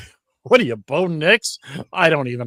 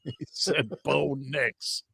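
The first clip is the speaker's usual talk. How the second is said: imitating British accent; 7 dB quieter, much slower than usual. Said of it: "damn British accent".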